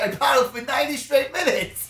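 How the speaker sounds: loud, high-pitched complaint; retold yell, outraged at doing all the work; indignant protest. Paddler protesting to friends who didn't paddle, indignant.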